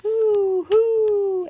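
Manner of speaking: high, loud hooting; two long, high owl calls; owl's hoot. Acted. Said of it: "asked the owl".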